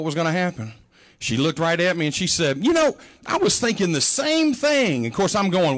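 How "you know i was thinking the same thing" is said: high, slow, drawn-out mother's reply; mother agreeing. Retold or imitated. Mother admits to her son the tea tastes bad, higher and slow. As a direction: let her admit it with a knowing lilt.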